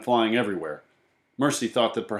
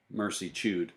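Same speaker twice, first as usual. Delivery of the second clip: ordinary delivery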